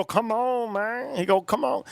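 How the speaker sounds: a high mock Chinese-accented "come on man"; mimicking the Chinese restaurant owner, high and soft; imitated Chinese accent. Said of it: "How does he talk?".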